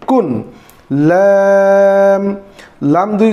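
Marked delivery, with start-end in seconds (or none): 1.1-2.2 very slow, stretched আইন; drawn-out letter name. Shown as a demonstration. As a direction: hold আইন long as a model for pupils. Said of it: "teacher drawing out letter name".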